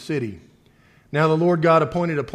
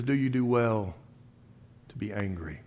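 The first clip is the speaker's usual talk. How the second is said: gentle God voice; 5 semitones lower, 8 dB quieter than usual. Acted. God's question voiced softly again.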